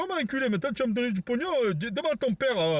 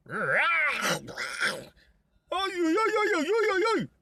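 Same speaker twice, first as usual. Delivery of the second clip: pained yelps; 7 semitones higher than usual. Acted. Bitten by dog, crying out.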